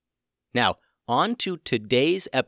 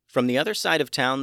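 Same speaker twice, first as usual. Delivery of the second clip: ordinary delivery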